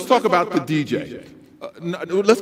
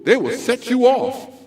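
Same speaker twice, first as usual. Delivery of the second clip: usual speaking voice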